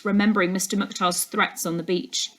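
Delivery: ordinary delivery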